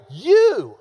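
YOU punched high and loud; emphatic YOU. Shown as a demonstration. Preacher showing how Paul stresses it, emphatic YOU. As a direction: hit YOU hard as one drawn-out word. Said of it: "He's emphatic".

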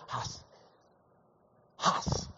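"hus" with an open ah-like vowel, whispered; Hebrew word. Shown as a demonstration. Preacher teaching the Hebrew word "hus", whispered with an ah vowel. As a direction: say "hus" with ah, not oo. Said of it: "more of a ah sound than an oo sound".

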